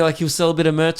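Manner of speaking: ordinary talk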